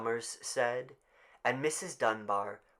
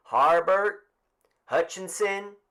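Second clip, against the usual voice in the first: higher, louder roll call; raised roll call; calling out names; 9 semitones higher, 9 dB louder than usual. Acted. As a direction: read names to the crowd.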